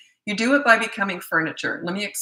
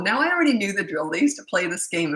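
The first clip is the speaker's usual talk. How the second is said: ordinary delivery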